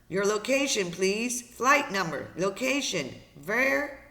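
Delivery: urgent radio operator. Acted. Operator asks boy for location and flight number, urgent demand. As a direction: push each request through the static. Operator calling through lost signal.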